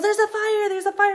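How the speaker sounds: high urgent warning of fire; imitating a frantic shout. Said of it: "Saya goes".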